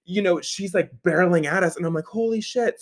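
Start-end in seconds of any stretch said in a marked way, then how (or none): 2.1-2.8 high, sudden alarmed curse; startled curse. Retold or imitated. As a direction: blurt it out as the car comes at you. Car about to T-bone them.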